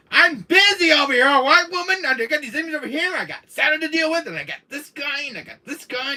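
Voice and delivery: high, exasperated rant; playfully overwhelmed complaint; harried comeback. Acted. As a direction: list your troubles in a high, pestered voice. Acts out hero's harried comeback.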